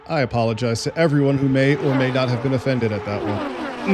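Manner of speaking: normal talking voice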